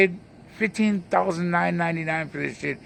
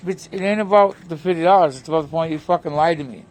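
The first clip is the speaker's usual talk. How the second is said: ordinary talk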